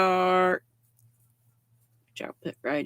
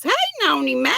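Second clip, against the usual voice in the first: excited greeting; 9 semitones higher, 3 dB louder, much faster than usual. Real, not acted. Greeting live viewer.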